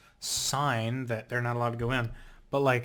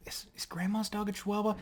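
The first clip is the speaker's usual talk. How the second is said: softer, breathless whisper to herself; a quick under-the-breath check; whispered aside; 7 semitones higher, 9 dB quieter, faster than usual. Real, not acted. Vlogger asking herself mid-story whether the dog counts, whispering.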